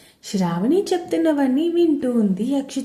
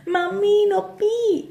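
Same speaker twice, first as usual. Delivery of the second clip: much higher, mock cry of pain; playful yell of pain; 10 semitones higher, slower than usual. A narrator's reading. Brinda yelps as her ear is twisted, mock pain. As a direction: squeal it like an exaggerating kid. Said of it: "గట్టిగా అరవడం మొదలుపెట్టింది".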